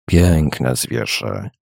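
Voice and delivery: low, very drawn-out, chilling tone; piercing, haunting voice. A narrator's reading. Stranger eyeing the horse makes Charlie shudder, piercing voice. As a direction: draw it out slowly and low, to chill the listener. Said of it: "rzekł głosem tak przejmującym".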